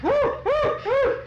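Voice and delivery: very high loud hooting whoops; stork's loud whoops. Acted. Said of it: "the stork was way up high in the tree and went".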